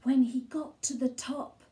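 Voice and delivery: ordinary delivery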